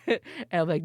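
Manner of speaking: ordinary delivery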